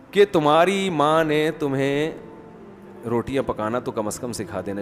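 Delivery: leisurely mother-in-law needling; very calmly. Acted. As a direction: draw out the reproach, then drop it quietly. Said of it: "لیکن بڑے آرام سے".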